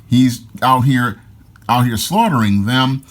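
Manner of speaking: ordinary delivery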